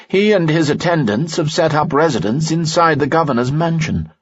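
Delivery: a worldly friend warning gently. A narrator's reading. Lanson explains.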